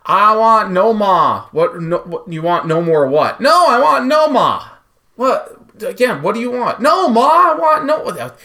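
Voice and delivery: loud, high Boston voices bickering; Boston accent skit. Pretend. Cohost plays a Boston kid and mom over Nomar, loud.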